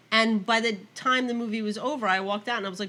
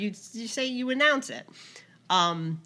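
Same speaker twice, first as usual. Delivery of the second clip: ordinary delivery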